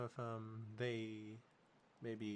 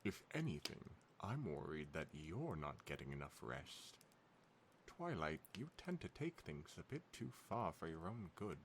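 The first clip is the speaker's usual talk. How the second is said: put-on a loose, half-formed British accent, quiet; fancy British lilt, only half committed; vaguely British accent; 3 semitones lower, 6 dB quieter than usual. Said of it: "I don't know what accent I'm doing, but it's kind of, like, British".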